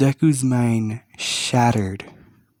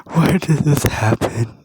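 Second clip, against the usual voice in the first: high, louder, cracking despair; laughing while weeping; 5 semitones higher, 3 dB louder than usual. A narrator's reading. Deku asking why him, laughing through tears. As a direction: cry out why between laughs. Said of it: "laughing while weeping".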